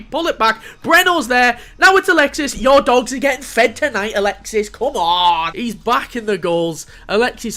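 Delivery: loud, high goal celebration; excited shouting. Real, not acted. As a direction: roar the chance home.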